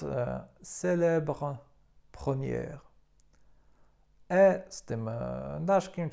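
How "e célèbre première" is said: "célèbre" slowly, then "première" with an open È and a French R; teacher showing accent grave; French pronunciation. Shown as a demonstration. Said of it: "Na przykład".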